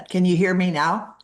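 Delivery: ordinary delivery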